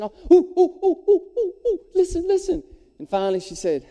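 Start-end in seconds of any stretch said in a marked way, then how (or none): 0.3-2.6 put-on high, loud ooh-ooh of a kid dying to be picked; schoolboy straining to get the teacher's attention, high and loud; eager little boy. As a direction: bounce in your seat calling ooh, pick me. Eager boy begging to be called.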